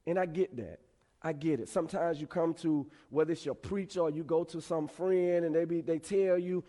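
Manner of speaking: normal talking voice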